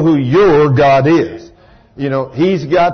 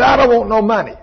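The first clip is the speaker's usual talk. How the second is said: retold refusal; 5 semitones higher than usual. Girl refuses the offered money.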